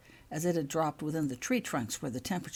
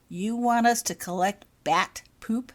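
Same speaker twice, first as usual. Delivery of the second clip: a raised, disgusted question; 5 semitones higher, 6 dB louder than usual. A narrator's reading. Albus to the professor, louder and disgusted. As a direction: recoil at the idea of collecting it. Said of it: "voicing the same disgust Holly felt".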